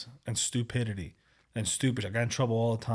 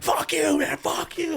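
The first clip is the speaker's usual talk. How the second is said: mock angry driver; 5 dB louder, slower than usual. Imitating the furious driver.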